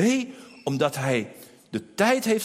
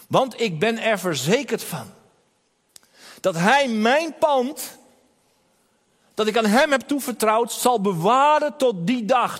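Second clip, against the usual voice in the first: raised, louder sermon voice with punched words; preaching at full force; 4 semitones higher, 4 dB louder than usual. Acted. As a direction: build the preaching to a confident climax. Preaching crescendo.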